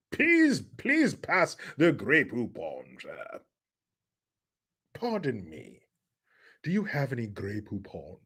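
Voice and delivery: soft, slow posh British parody; hushed upper-crust British put-on; mock posh British. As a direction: ask with exaggerated British refinement. Parody of posh mustard advert.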